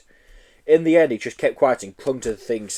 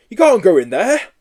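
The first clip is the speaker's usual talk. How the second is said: loud high shout; 9 semitones higher, 8 dB louder than usual. Acted. Policeman shouting after the Yeti, loud shout. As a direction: yell it like a copper. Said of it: "shouted the policeman".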